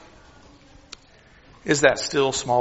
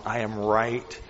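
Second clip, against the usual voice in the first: softer, low and close; hushed whisper; 5 semitones lower, 8 dB quieter than usual. Pretend. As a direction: murmur it as near as can be. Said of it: "The Lord was wanting Elijah to know".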